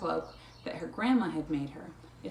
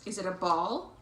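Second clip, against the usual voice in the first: ordinary delivery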